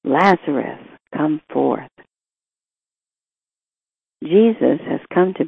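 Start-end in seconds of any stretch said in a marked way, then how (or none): none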